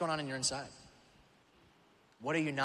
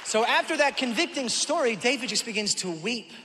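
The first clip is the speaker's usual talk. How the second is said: high, loud preaching surge; 6 semitones higher, 7 dB louder than usual. Acted. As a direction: hit the turn of the story hard. Sermon climax.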